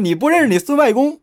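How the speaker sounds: high, loud cheeky taunt; Monkey King imitation. Teller imitates Sun Wukong taunting a demon, high and cheeky. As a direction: brag like a cocky monkey. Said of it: "一般孙悟空都是".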